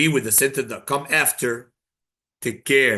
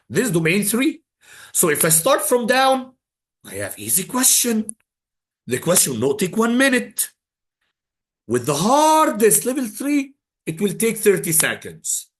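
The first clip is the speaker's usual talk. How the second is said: excited push; 8 semitones higher than usual. Real, not acted. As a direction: pump the class up about the easy questions. Teacher rallying students about exam timing.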